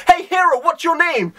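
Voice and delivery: high, demanding shout-after; mock demanding call-out. Goro demanding the departing hero's name, mock demanding call-out. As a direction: call after someone, pitched well up.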